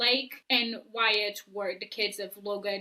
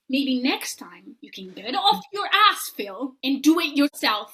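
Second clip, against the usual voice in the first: loud, scolding rant at Phil; loud mock confrontation, fed up with him; heated telling-off; 3 semitones higher, 5 dB louder than usual. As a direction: lay into him, building higher as you go. Acted telling-off addressed to Phil.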